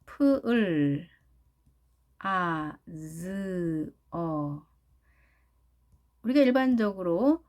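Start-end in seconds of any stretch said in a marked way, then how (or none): 0.1-4.6 프을, 아, 즈, 어 drawn out separately; slow isolated sounds 프을, 아, 즈, 어 with pauses. Shown as a demonstration. Teacher breaking plaza into sounds, slow and separate. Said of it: "영어식으로 발음해 볼까요".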